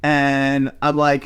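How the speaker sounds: ordinary delivery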